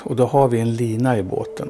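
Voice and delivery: usual speaking voice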